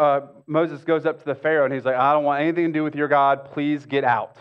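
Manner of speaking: usual speaking voice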